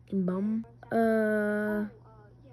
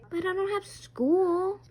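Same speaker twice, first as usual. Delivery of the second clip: put-on pleading kid voice; 8 semitones higher, 4 dB louder, slower than usual.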